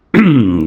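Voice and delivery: loud throat clearing. Real, not acted. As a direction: clear your throat hard. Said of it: "Sorry, morning voice".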